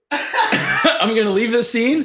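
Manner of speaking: high, slow laughing words. Real, not acted. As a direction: laugh it out while talking. Laughing through hot wing pain.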